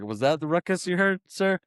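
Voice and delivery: high-pitched, rising innocence; mock innocent question. Kids covering for their hidden friend, mock innocent. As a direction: play innocent with the principal.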